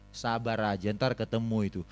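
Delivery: a wife quietly telling her husband to be patient; wife calming him. Retold or imitated. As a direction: pat his shoulder with your voice and calm him down. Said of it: "istri saya tepuk pundak saya bilang begini".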